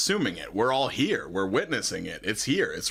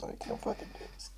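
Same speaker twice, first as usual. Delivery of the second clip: whispered; 14 dB quieter, much faster than usual. Real, not acted. Overwhelmed, whispered aside.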